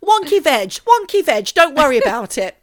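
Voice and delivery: high, loud hammed-up voices; mock kid and auntie exchange. Host acting a niece catching her aunt, hammed-up and high. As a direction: play both kid and flustered auntie, way up high.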